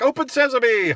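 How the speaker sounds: high, loud hammed-up "Open sesame!"; mock magic command. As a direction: boom it like a cartoon wizard. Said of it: "I'll come in and be like".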